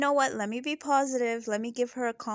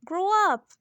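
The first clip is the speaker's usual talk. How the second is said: louder, higher, fed-up command; exasperated repeat; 8 semitones higher, 4 dB louder than usual. Real, not acted. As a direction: snap it back at her louder.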